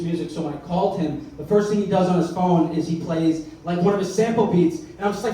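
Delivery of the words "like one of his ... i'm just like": normal talking voice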